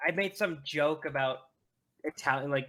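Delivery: normal talking voice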